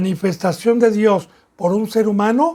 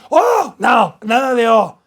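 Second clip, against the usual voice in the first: scolding; 2 semitones higher, slower than usual. Retold or imitated. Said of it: "cuando le digan".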